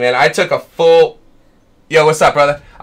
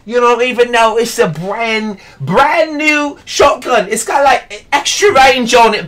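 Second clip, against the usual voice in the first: bad British impression; 6 semitones higher than usual. Streamer parodying a YouTuber's wack British impression, hammed-up. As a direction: overblown fake British YouTuber begging for subscribers. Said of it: "bad British impression".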